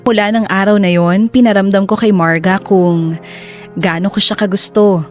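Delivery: ordinary delivery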